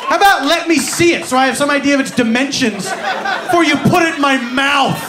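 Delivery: loud, fast, fed-up ranting; exasperated rant. Retold or imitated. Patient ranting at the x-ray tech, loud and fast. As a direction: fire the complaints back, fast and annoyed.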